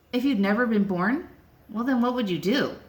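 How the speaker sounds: usual speaking voice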